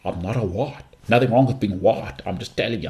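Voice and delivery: normal talking voice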